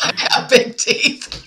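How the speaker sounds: slow, laughing self-tease. Real, not acted. Answering the compliment about her smile, laughing. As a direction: giggle through it, embarrassed. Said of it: "big, toothy, beautiful smile".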